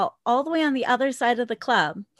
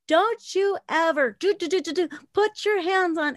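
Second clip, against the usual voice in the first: mild mock-angry telling-off, pitched higher; retold cussing out; 5 semitones higher than usual. Dancer cussing out the customer who grabbed her, retold scolding. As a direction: act out a scolding without shouting, bleeping the curses. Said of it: "start cussing this guy out".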